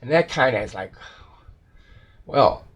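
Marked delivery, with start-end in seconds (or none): none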